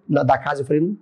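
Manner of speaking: ordinary delivery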